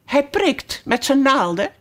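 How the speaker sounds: lightly voiced high little flower; a hint of a shrill daisy; daisy calling out. A narrator's reading. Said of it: "riep een Margriet".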